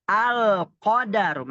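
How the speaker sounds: slow model al-qadaru; clear lam in al-qadaru. Shown as a demonstration. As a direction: stretch out the l of al-qadaru. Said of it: "alif lam qamariyah kayak tadi".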